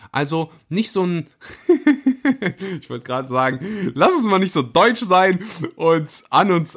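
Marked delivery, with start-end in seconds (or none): 1.7-2.8 a high, bright burst of laughter. Real, not acted. Host cracking himself up mid-sentence, bursting out laughing. Joking about being too German. 2.8-6.8 words still bubbling with laughter, the last line higher; laughing while talking. Real, not acted. Podcast host teasing German listeners, laughing through the words. Joking about being too German.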